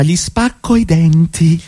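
slow, deliberate menace; jealous husband threatening the lover, slow and menacing; slow threat. Acted. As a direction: spell out the threat word by word.

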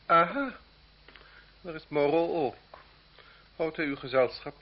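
loud mock welcome. The marquis spotting Moreau, loud aha. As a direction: greet him with haughty surprise.

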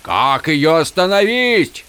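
high, slow cry to a god; urgent, high-pitched call; desperate shout. A narrator's reading. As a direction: beg for an answer over the storm. Desperate plea shouted at storm.

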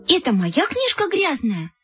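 high-pitched offended complaint; outraged appeal. A narrator's reading. Offended girl complains to Kolya about her friend, outraged.

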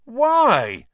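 loud, very high, drawn-out question; high loud wail of protest; chorused. A narrator's reading. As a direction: cry out as a whole dismayed team. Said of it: "chorused the rest of the team".

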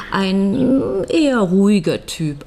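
normal talking voice

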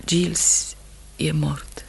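slow, hushed whisper; barely voiced, slow and grave. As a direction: let the grim news out in a whisper. Wife breaks news of death.

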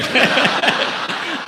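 breathy unvoiced chuckle. Real, not acted. Preacher laughs.

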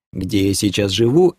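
ordinary delivery, nothing marked

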